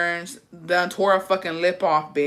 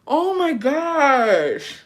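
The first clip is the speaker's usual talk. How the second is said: high, very slow drawn-out oh my gosh; long amazed exclamation; 8 semitones higher, much slower than usual. Real, not acted. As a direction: stretch it out in awe.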